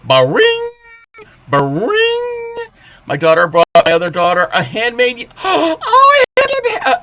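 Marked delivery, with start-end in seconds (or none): 0.0-2.6 very high sung ding; high squeaky ring. Pretend. Playful sound effect. 5.4-7.0 very high gasping squeal; touched squeal. Real, not acted. Touched gasp at gift.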